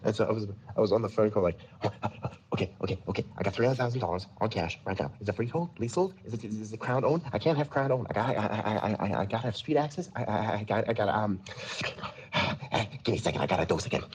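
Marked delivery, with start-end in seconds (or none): 2.5-14.0 rapid, stammering druggie buyer; messed-up meth dealer. Acted. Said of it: "speak as fast as humanly possible and try to sound really messed up".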